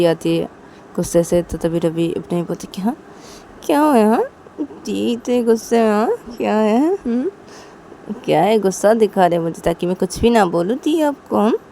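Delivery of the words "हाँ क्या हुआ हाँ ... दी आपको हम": higher, louder mocking brother; loud cheeky brother teasing sister; taunting brother. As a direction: needle her loudly, cheeky. Ravi taunting angry sister.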